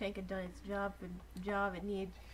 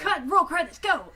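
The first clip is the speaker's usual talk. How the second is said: mock director shout; 9 semitones higher, 15 dB louder than usual. Mock director ending the scene.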